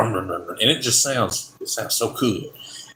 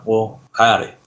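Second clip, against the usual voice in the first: put-on southern accent; 2 semitones lower than usual. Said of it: "your very southern accent".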